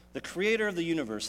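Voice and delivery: ordinary talk, nothing marked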